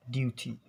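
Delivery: "duty" as dyoo-tee; British pronunciation. Shown as a demonstration. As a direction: clear t and y-glide in "duty", British style. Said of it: "in British".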